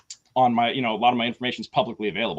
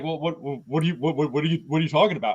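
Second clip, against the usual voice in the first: flustered stammer; 2 semitones higher, faster than usual. Retold or imitated. Caller stammering in confusion.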